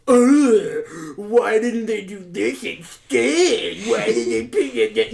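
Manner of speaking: mock an outraged commenter as a slow, nasal-free whine; hammed-up petty online complainer; whiny complainer. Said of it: "those people who think they're".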